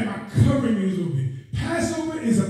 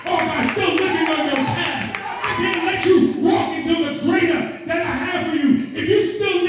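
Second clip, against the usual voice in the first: high-pitched, relentless pulpit exhortation; impassioned preacher speaking God's charge; fervent preaching climax; 7 semitones higher than usual. Real, not acted. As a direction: drive each phrase home to the congregation. Preaches climax, voicing God.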